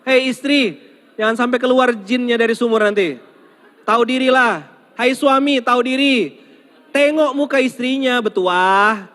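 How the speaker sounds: high, loud preaching; loud exhortation. Real, not acted. Preacher urging wives and husbands to face each other, loud and high. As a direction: rouse the couples with force.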